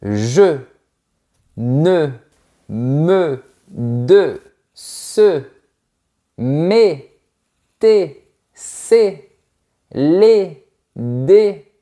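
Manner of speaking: «me de ce» drawn out, each short E sounded; slow classroom drill of short words; slow pronunciation demo. Shown as a demonstration. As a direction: spell out the little words slowly. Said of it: "on dit".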